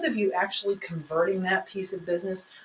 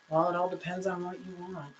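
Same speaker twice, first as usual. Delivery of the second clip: dismissive hedge; 3 semitones lower, 7 dB quieter, faster than usual. Shown as a demonstration. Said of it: "no words instead of flow words".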